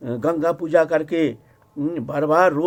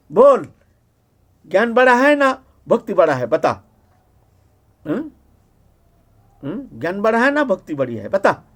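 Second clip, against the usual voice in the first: angry demand; 3 semitones higher, 6 dB louder than usual. Retold or imitated. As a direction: demand an answer while dealing out punishment. Acted angry interrogation during a beating.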